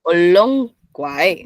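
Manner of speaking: deliberate two-part "Olong guae"; split two and two. Shown as a demonstration. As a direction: stretch "Olong", then "guae" separately. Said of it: "regla dos de entonación".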